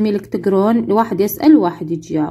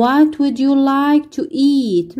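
each English word spelled out slowly for the class; very slow model reading; 4 semitones higher, much slower than usual. Shown as a demonstration. Teacher playing flight attendant, very slow model question. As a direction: read it slowly so pupils can repeat. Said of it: "ممكن تسألون أنتم".

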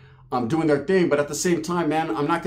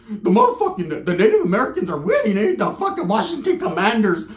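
laughing aside; 6 semitones higher, 3 dB louder than usual. Real, not acted. Laughing about football losses.

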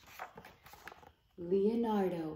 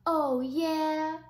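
retort; 6 semitones higher, 10 dB louder than usual. Acted. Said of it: "replied Leonardo".

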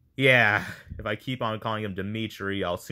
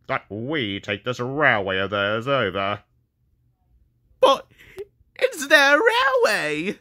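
snobbish, raspy pinched-nose voice, high and drawn out; hammed-up snob engine, nose lightly pinched; D199 voice; 4 semitones higher, 4 dB louder than usual. Shown as a demonstration. Showing auditioners how D199 should sound, snobbish raspy voice. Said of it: "this is what I want D one nine nine to sound like".

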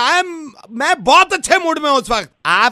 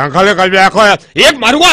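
louder, lower mock threat to a kid; 7 semitones lower, 6 dB louder than usual. Put-on uncle threatens kid.

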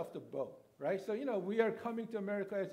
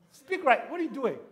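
scolding mom; 6 semitones higher, 13 dB louder than usual. Retold or imitated. Mom scolds son's bad Korean.

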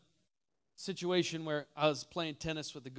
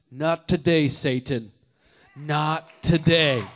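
loud, slow, defiant; defiant rebuke; 14 dB louder, slower than usual. Retold or imitated. Preacher rebuking Satan with each painful step, defiant and loud. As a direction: stare down the pain and refuse it. Running on broken foot.

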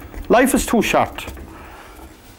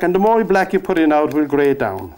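ordinary talk, nothing marked

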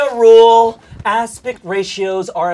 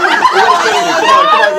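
breaking up with laughter while hushing; laughing; 6 dB louder, faster than usual. Real, not acted. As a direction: try to calm down but keep laughing. Laughing, calming crowd.

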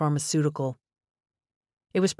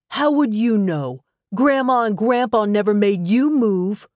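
angry retort; 6 semitones higher, 5 dB louder than usual. Acted. Spencer lashes out at father.

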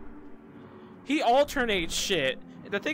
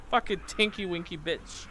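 ordinary delivery

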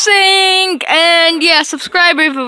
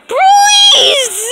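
high pleading cry; begging shout; 4 dB louder than usual. Pretend. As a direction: shriek your plea. Begging at disease man.

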